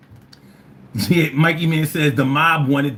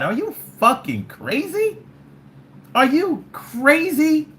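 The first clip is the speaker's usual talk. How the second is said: higher, slow disbelieving cry; can't believe anyone says that; incredulous; 10 semitones higher than usual. Real, not acted. Disbelief at Trump fans.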